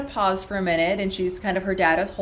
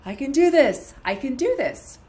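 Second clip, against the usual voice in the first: high repeated mantra gathering firmness; imitated voice changing; 7 semitones higher than usual. Patient repeating the script, high voice growing firmer. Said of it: "And then her voice started".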